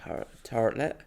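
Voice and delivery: soft, tentative Somerset try; questioning Dorset accent. Shown as a demonstration. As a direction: try out a West Country TARTLET, quietly. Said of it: "very Dorset and Somerset now".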